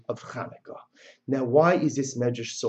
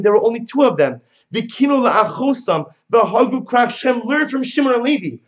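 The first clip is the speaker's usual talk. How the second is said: raised high preaching rebuke; rebuking bride; 7 semitones higher, 6 dB louder than usual. Acted. Bride rebuking her brothers, raised fierce voice. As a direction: lecture them like a fierce bride.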